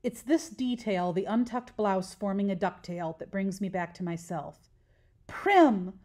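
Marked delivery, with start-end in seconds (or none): 5.3-6.0 strangled cry. Acted. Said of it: "The strangled cry".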